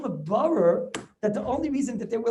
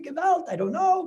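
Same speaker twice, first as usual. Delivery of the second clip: mock scream; 8 semitones higher than usual. Rebbe voicing the Rashba starting to scream. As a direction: cry out in panic as the Rashba. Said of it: "the Rashba starts screaming".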